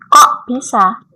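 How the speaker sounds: ordinary talk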